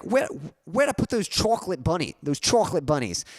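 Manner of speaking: put-on high, loud New York accent; Aunt Kathy. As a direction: play an excited New York aunt hunting for something. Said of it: "making fun of her accent".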